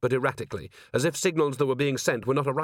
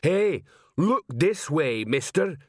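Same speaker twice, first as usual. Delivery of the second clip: slow, high troll call; troll hailing someone, drawn out; troll sergeant; 5 semitones higher, slower than usual. A narrator's reading. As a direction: get an enemy's attention as a troll. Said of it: "said Detritus".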